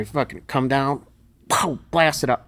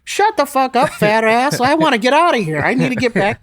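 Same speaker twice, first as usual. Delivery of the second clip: higher and louder, rushed mock scolding; gruff impatient coach; 12 semitones higher, 7 dB louder than usual. Lou yelling at injured lifter to hurry up, impatient shout. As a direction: snap at him to stop whining. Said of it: "He goes".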